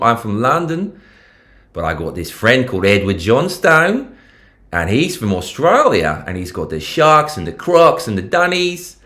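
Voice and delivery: playful Australian impression, lilting upward; Australian accent. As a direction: put on a broad Aussie voice. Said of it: "Rate my Australian accent".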